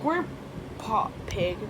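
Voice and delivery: usual speaking voice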